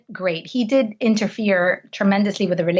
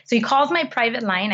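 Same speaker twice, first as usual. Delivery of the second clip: ordinary talk, nothing marked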